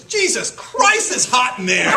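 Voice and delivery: loud, high exclamation; kid's outburst. Retold or imitated. Boy popping out of an egg, loud high yelp. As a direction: blurt it out with a high yell. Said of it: "he goes".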